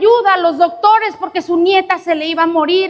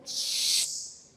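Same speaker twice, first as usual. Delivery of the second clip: hush; 17 dB quieter than usual. Acted. Prayer group hushing her.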